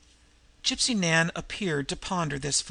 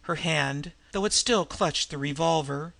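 usual speaking voice

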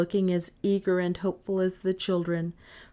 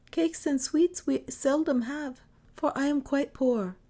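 gentle mother; 6 semitones higher than usual. A narrator's reading. Mother voiced in story.

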